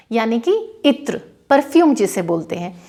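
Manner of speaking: ordinary delivery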